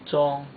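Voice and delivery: "Zhong" pitched high and held level; high first tone. Shown as a demonstration. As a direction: keep "Zhong" high all through. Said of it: "You wanna keep it high then".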